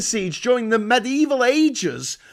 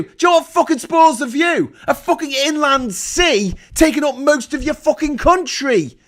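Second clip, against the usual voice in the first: shouting louder and higher in fury; angry shout; 5 semitones higher, 4 dB louder than usual. Real, not acted. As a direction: bellow it at the government. Furious rant at government.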